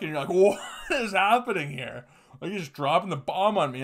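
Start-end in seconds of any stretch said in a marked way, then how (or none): 0.3-2.0 baffled question. Retold or imitated. Baffled reaction to doc.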